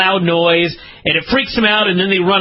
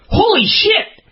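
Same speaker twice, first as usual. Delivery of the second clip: startled exclamation; slower than usual. Retold or imitated. Retelling the cat falling from the stairs, a high startled cry. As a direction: jump out of your skin. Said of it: "And I'm like".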